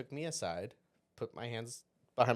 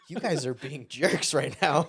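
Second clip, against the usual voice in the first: laughing retort; 5 semitones higher, 8 dB louder than usual. Retold or imitated.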